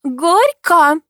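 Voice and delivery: high, loud celebratory squeal. A narrator's reading. Neighbour urging the couple to kiss, squealing. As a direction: squeal it out suddenly. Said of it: "вдруг взвизгнула соседка".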